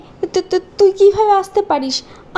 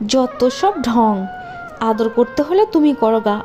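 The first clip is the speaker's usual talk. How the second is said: low, sneering; sneering at her husband; scornful stepmother; 8 semitones lower than usual. Acted. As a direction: scoff at the fuss.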